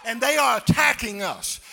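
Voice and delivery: loud, rising, chanted preaching; full-force preaching. Acted. Preacher rallying the congregation, loud chanted preaching. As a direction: build them up and shout it home.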